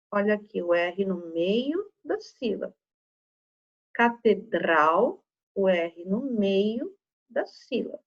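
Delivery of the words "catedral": "Catedral" drawn out slowly, R pushed; forced R. Shown as a demonstration. Teacher helping pupils notice the sound, forced R in "Catedral". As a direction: stretch "Catedral" and lean on its dr. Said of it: "dando essa forçadinha no R".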